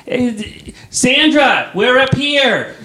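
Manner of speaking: high, loud parent call across the crowd; mock parent yelling to a lost kid, high and loud. Parent yelling at kids in line.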